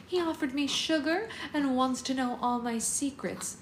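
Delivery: a playful mock-seductive purr. Katniss imitating Finnick for Peta, mock seductive. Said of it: "in my best seductive voice".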